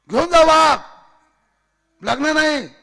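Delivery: high, loud, urgent dismissal; shouted refusal. Acted. Official behind closed gate sending tiger off, shouting. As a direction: shout them away from the gate.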